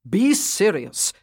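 slow, firm command; stern command. A narrator's reading. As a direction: order them to stop laughing. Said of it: "he commanded the two of them".

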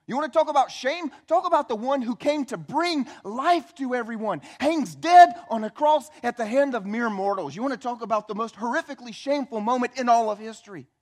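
high, loud, driving sermon delivery; forceful preaching. Acted. Preacher to congregation on the shame of the cross, forceful.

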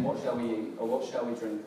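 normal talking voice

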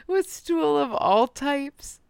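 laughing through the words; can't keep it together. Real, not acted. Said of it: "I can't keep it together".

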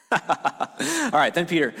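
laughing through the words; a laughing reset after the slide fumble. Real, not acted.

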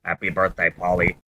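very low robotic monotone; creepy robot voice. Retold or imitated. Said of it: "creepy robotic male voice".

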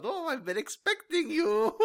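high put-on villain voice. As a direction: purr it like a movie villain. Villain reveal in the chair.

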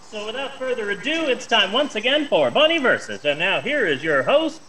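high announcer calling the host in; game show host. Acted. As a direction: introduce the star with flair. Host introduction.

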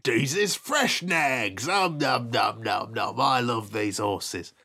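slow, low greedy troll; lightly voiced slow troll gloating over food. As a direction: chew through it like a dumb troll. Playing troll eating horses.

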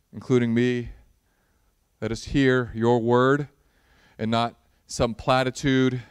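measured, earnest prayer with long pauses. Acted. Pastor praying before the Bible reading, earnest and measured. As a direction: pray over the congregation, unhurried.

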